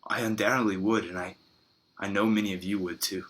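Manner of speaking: normal talking voice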